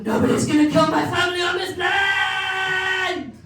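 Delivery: high, loud, slow heroic cry; hammed-up mother's battle cry; dramatic shout. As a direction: belt it like an action hero. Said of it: "She doesn't talk like that".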